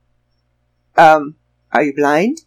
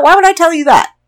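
incredulous; 12 semitones higher, 3 dB louder, faster than usual. Retold or imitated. Incredulous retort to scammer.

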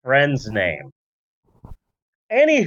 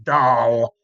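put-on slow, lower old-timey drawl; a mock thirties charmer leering; 1930s man voice; 6 semitones lower, slower than usual. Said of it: "everyone in the nineteen thirties and forties is like".

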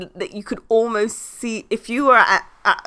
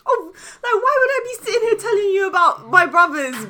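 high, laughing "Me?" half crying; laughing disbelief; 8 semitones higher, 5 dB louder than usual. Retold or imitated. Telling her mum it's her baby, laughing exasperated.

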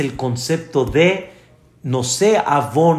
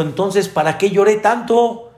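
put-on higher, complaining little boy; higher child voice whining to mom; whiny child; 5 semitones higher than usual. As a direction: protest like a cheated kid. Child complains in joke story.

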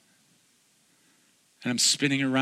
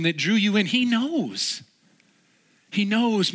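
rising, urgent sermon appeal to the room, climbing higher; impassioned preaching; 4 semitones higher than usual. Acted. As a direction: plead with the congregation, building to a peak. Appeal to the congregation.

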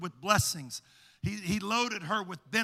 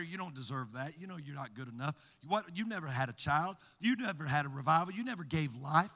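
lower, much softer sneering whisper-like taunts; taunting adversary voice; 3 semitones lower, 5 dB quieter than usual. Acted. Peninnah taunting Hannah behind her, low and soft. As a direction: hiss doubts at her from behind. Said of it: "there's this voice".

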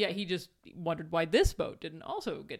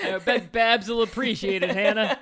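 laughing; 8 dB louder than usual. Real, not acted. Co-host laughs along at the message.

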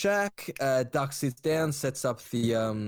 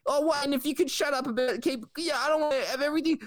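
much higher, fast and panicky; flustered Ricky; 11 semitones higher, faster than usual. Retold or imitated. Ricky panicking as Duck spills secrets, high and fast.

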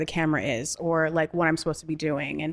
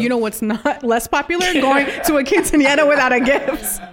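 mock scolding; 7 semitones higher, 6 dB louder than usual. Teasing scolding about no gift.

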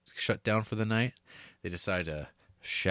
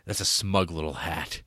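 lightly voiced sneering Giovanni, lower; a hint of a disdainful suitor; 6 semitones lower than usual. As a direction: drop the voice for a snooty put-down. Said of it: "He's like, he's like".

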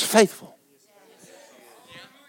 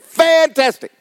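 mock cheer; 12 semitones higher, 5 dB louder, slower than usual. Retold answer to his wife.

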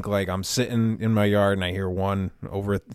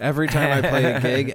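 usual speaking voice

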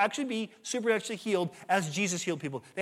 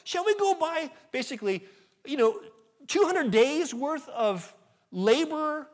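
higher, louder incredulous sarcasm; sarcastic disciples; 4 semitones higher, 8 dB louder than usual. Retold or imitated. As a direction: scoff at an impossible request. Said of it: "a hint of sarcasm in their voice".

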